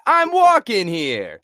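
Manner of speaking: loud, drawn-out, high New York yell. Retold or imitated. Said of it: "all the stereotypes of New York".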